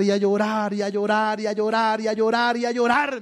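chanted run of repeats, swelling to a peak; building repetition. Retold or imitated. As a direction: pile up each repeat without stopping, climbing. Acting out unstoppable weeping in testimony.

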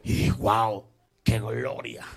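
deep, mocking wow; mock awe. Preacher mocking the smoke and lights, deep mock awe. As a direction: sneer at the fake glory.

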